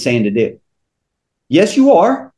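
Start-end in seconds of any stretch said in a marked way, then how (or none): 1.5-2.3 mock retort pitched higher and louder; a quick knowing comeback that calls their bluff. Speaker answers a listener who claims not to know, with a knowing retort.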